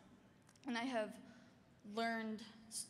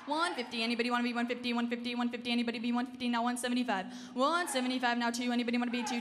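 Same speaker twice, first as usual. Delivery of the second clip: rapid rhythmic auction chant; auctioneer; 3 semitones higher, 10 dB louder, faster than usual. Acted. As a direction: rattle off bids in a fast chant. Live auction bid calling.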